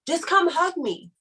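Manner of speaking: ordinary delivery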